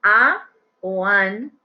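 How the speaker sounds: slow, spaced a o an; slow model. Shown as a demonstration. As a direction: drag out each option for the class.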